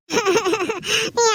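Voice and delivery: acted pulsing sobs; sister's sobbing. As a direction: burst into sympathetic crying. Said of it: "ನಿನ್ ತಂಗಿನೂ ಅಳ್ತಾಳೆ". (ತಂಗಿಯ ಬಿಕ್ಕಳಿಕೆ)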